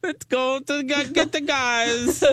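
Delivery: hammed-up Chicago girl voice; Chicago accent. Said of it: "We do not talk like that".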